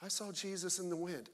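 soft, mocking little voice; softly mock a sappy worship lyric; feeble, hushed imitation; wimpy song parody. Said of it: "these little wimpy songs".

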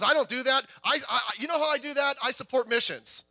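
smug churchgoer. Retold or imitated. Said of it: "He goes".

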